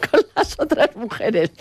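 se le escapa la risa al contarlo; laughing through the punchline of an anecdote; entre risas. Real, not acted. Se ríe contando la anécdota. (aguantándose la risa)

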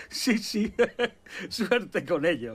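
high, laughing dismissal; mocking laughter. Prisoner leaving, wishing old inmate luck, laughing. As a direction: humour him while laughing it off. Mocks the escape plan while leaving.

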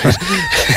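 high burst of laughter; laughing burst. Real, not acted. Laughs at being worn out. (carcajada aguda)

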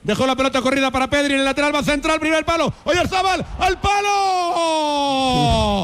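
fast, climbing call into a long high cry; excited live play-by-play. Real, not acted. Commentator calling Oyarzabal's shot onto the post, rising excited play-by-play. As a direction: race through the move, then stretch the last word into a wail.

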